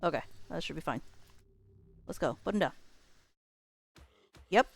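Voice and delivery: ordinary talk, nothing marked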